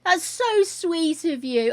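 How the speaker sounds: high, loud, slow gushing with laughing breaks; overjoyed. Real, not acted. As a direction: melt with delight at a gift. Overjoyed at gift from neighbour.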